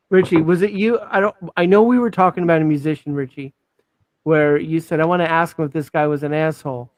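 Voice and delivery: usual speaking voice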